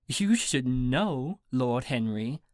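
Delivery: slow, teasing British lady; snickering British accent. A narrator's reading. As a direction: tease drawn-out in a British accent. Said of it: "she snickered".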